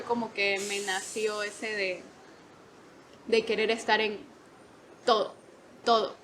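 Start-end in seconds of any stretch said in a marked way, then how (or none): none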